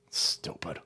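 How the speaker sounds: unvoiced hissed whisper; whispered insult. Real, not acted. Insulting the IRS listeners in a whisper. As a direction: whisper it with contempt.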